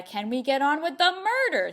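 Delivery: rising, loud, exasperated demand; mock impatience. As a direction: push it out impatiently, building to the end. Mocks the book's slow pace.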